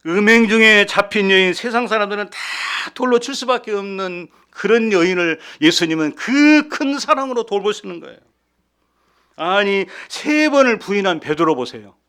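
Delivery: loud, high, driving sermon voice; fervent preaching. Acted. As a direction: preach it hard to the congregation. Preaching at full force.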